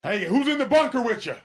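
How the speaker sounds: mock pep-talk, battle-cry pitch; mock war-talk coach. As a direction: pump up the troops for battle. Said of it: "What we always use is".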